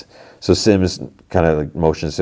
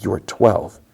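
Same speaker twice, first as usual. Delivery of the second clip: ordinary talk, nothing marked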